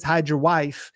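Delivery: normal talking voice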